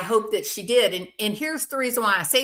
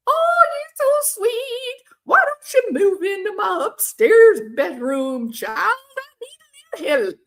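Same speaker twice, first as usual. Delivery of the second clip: Mother Abigail; 13 semitones higher, 6 dB louder than usual. Pretend. Old lady inviting the grifter to move in, high cooing old woman. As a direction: coo like a trusting elderly matriarch. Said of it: "I sound like Mother Abigail from The Stand".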